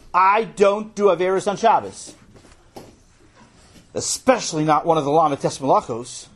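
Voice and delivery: loud, high-pitched protest; indignant. Acted. Boy refusing his brother on religious grounds, loudly indignant. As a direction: declare it with righteous outrage.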